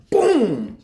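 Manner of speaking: imitated crash, high and loud; loud boom. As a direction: shout the car's boom. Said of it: "my car said".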